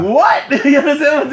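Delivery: high laughing "what"; laughing disbelief. Real, not acted.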